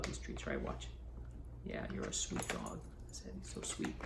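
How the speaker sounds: hushed whisper to the dog. Real, not acted. Author giving his dog Teddy a treat, hushed whisper.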